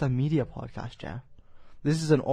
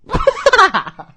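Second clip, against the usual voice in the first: very high, loud cackle; shrieking laugh; 13 dB louder than usual. Real, not acted. Host hearing a childish rude word, shrieking with laughter. Bursts out at "winky.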